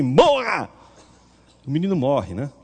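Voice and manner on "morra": a sharp, higher, louder curse; angry command. Retold or imitated. Child Jesus cursing the boy who tripped him, sharp loud command. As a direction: spit the word out like a sudden verdict.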